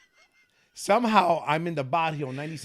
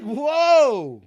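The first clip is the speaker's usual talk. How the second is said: mock surprise; 11 semitones higher, 9 dB louder than usual. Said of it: "I'm like".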